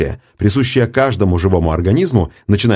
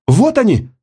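high excited cry; shouted; 13 semitones higher than usual. A narrator's reading. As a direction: cry out on spotting them. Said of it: "закричала Мраскова". (громкий высокий крик)

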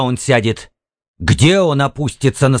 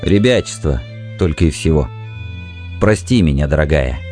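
tired apology, sinking in pitch; weary; 6 semitones lower than usual. A narrator's reading. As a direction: apologise wearily with a forced smile. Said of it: "устало через силу улыбнулся". (устало, через силу)

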